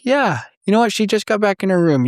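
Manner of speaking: lightly voiced nurse giving good news; cheerful nurse. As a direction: play the upbeat nurse. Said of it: "And they're like".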